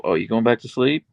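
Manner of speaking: ordinary talk, nothing marked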